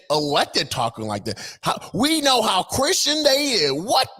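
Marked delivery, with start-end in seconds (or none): 1.6-3.7 slow, much higher mocking repeat; mocking echo. Vlogger echoing the clip to mock it, slow and high. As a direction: drag it out high in scorn.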